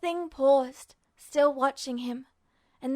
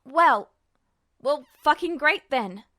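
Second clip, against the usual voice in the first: louder, sarcastic snap; bitter outburst; 5 dB louder than usual. A narrator's reading. Ed reacting to Truth, loud and sarcastic. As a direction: spit it out in frustration.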